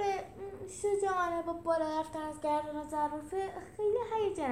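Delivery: high, hurt character voice, hesitant; wounded, high-pitched justifying; upset. Acted.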